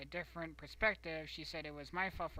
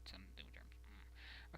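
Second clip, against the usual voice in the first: whisper; 6 semitones lower, 14 dB quieter than usual. Real, not acted.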